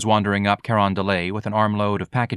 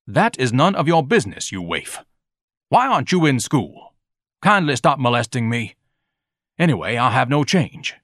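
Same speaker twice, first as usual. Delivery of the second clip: stuffy, haughty rebuff; pompous Ignatius, irritated; 4 semitones higher than usual. A narrator's reading. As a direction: brush the boy off with lofty annoyance. Voicing pompous Ignatius rebuffing the boy.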